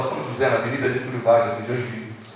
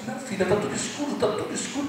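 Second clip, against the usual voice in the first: much higher yet much softer, rising in fear; whisper-thin alarm as everything goes dark; softly voiced frightened old father; weak frightened father; 9 semitones higher, 7 dB quieter than usual. Father losing sight, frightened.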